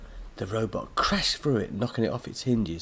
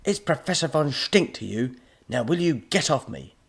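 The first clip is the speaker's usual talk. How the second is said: annoyed; 6 semitones higher, 4 dB louder than usual. Acted. Professor struggles under robot.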